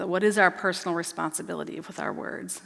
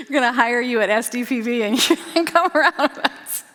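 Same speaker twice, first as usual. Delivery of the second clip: talking through a laugh, much louder; laughing invitation, a bit higher; 6 semitones higher, 8 dB louder than usual. Real, not acted. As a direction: laugh the joke out to the group. Host jokes while laughing.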